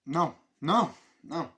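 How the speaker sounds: emphatic refusal. Retold or imitated. Retold refusal in film scene.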